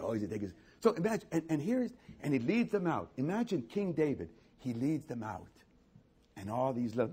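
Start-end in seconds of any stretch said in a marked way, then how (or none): none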